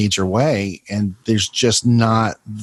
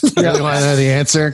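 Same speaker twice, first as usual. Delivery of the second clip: higher, louder, fast mocking echo; 6 semitones higher, 4 dB louder, faster than usual. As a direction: parrot it back in a silly voice. Echoing the line mockingly.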